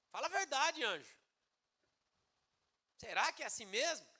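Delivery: high-starting sceptical challenge; doubtful challenge. Acted. Zacarias doubting the angel's word, sceptical challenge. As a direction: press for the truth in mock disbelief. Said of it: "Ele duvidou da".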